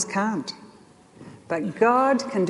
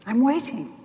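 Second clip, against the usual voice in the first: higher and softer, arms-wide welcome; gentle, inviting God; 5 semitones higher, 4 dB quieter than usual. Retold or imitated. God calling his children back, gentle and higher. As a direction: coax the child home. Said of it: "And he's saying".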